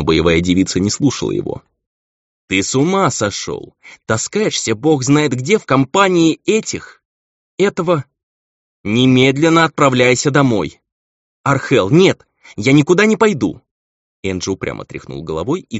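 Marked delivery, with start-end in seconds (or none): 2.5-10.8 loud, high, exasperated telling-off; indignant, raised voice; angry scolding. A narrator's reading. Angel scolds and orders him home. 11.4-13.6 loud, high-pitched refusal; raised voice, digging in; defiant protest. A narrator's reading.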